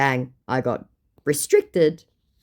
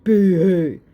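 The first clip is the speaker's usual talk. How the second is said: loud, high, drawn-out fake boo-hoo; mock sympathy; 5 semitones higher, 8 dB louder than usual. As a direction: taunt him with pretend crying. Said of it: "scammer got his feelings hurt".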